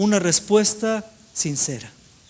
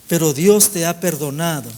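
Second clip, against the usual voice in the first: usual speaking voice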